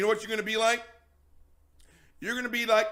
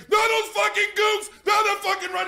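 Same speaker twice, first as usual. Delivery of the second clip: shouted, much higher, louder bigoted uncle; hammed-up uncle screaming at the guest; raging uncle; 12 semitones higher, 9 dB louder than usual. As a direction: yell it like the furious Vietnam-vet uncle. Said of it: "You're like".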